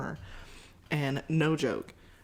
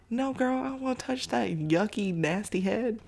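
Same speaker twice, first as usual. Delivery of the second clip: much higher, grossed-out refusal; disgusted; 6 semitones higher than usual. Retold or imitated. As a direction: recoil from the idea. Refusing to touch the baby's head.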